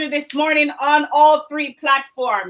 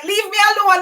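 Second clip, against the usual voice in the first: higher, playful imitation of a friend snapping; mock Audrey cussing; 8 semitones higher, faster than usual. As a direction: play her brushing you off, teasing.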